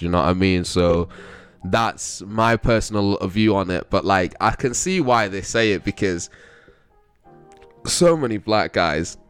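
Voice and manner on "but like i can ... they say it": ordinary delivery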